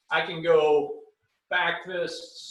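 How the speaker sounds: ordinary delivery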